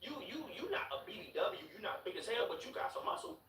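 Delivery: hyping her up. Retold or imitated. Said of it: "but I'm like".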